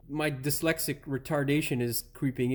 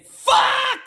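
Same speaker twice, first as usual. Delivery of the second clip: yelling a swear at full force, much higher and louder; furious shout; 10 dB louder than usual. Retold or imitated. Mechanic showing viewers how he yelled off camera, furious shout. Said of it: "what you missed off camera was me saying".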